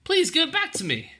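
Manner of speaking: put-on very high girlish protest; crying out. A narrator's reading. Ellen to the soldier taking her bread, high cry. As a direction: protest like a desperate child. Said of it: "cried Ellen".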